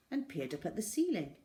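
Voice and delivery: plain reading voice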